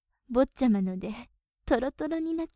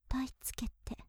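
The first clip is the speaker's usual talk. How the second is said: ear whisper; 9 dB quieter, faster than usual. Acted. Maid whispering into her master's ear, teasing. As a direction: murmur close and mischievous.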